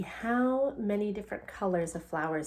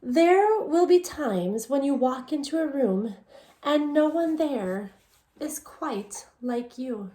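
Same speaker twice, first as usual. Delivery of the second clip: put-on reading a picture book aloud to children, a little louder; story narration; 4 semitones higher, 5 dB louder, slower than usual. A narrator's reading. Teacher reading the book to her students in story narration. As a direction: tell the story gently to the class.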